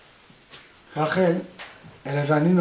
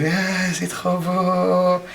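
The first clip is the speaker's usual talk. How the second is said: drawn-out gushing c'est trop beau; mock admiration; much slower than usual. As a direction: fake delight over a child's drawing. Parent admiring child's drawing. (admiration exagérée et traînée)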